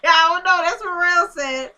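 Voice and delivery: high laughing voice, slowed down. Real, not acted. Vlogger laughing at a viewer's theory, laughing. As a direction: laugh through the words. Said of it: "y'all laugh".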